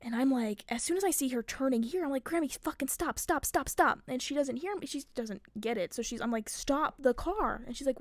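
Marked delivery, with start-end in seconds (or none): 2.2-4.0 higher, urgent repeated stops; mild mock panic; retold alarm. Retold panic at grandma's turn. 6.5-7.6 slow, drawn-out, higher command; urgent, stretched-out plea; emphatic retold order. Retold order to confused grandma.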